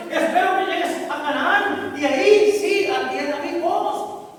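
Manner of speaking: loud, high preacher voicing God's reproach; impassioned preaching. Real, not acted. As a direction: proclaim the reproach to the congregation. (predicación a viva voz, con reproche)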